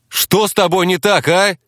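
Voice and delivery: high, irritated demand; exasperated outburst. A narrator's reading. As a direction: lose patience and demand an answer. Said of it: "взорвался я".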